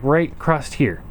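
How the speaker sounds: usual speaking voice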